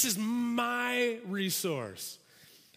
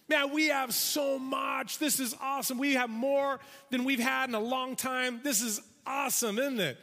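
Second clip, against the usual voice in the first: higher, eager burst of good news; a worker rushing in to tell the boss; excited farmhand; 4 semitones higher than usual. Acted. Excited field help bursts in.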